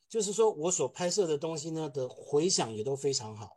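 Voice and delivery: ordinary talk, nothing marked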